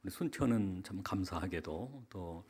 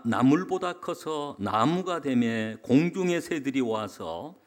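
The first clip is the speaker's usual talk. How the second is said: slow, raised, declamatory Bible reading; scripture reading; 4 semitones higher, 6 dB louder, slower than usual. Real, not acted. Pastor reading Matthew to the congregation, slow and declamatory. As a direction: proclaim the verse slowly, settling at the close. Reads a Bible verse aloud.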